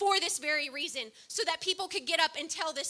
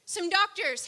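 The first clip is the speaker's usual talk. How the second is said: normal talking voice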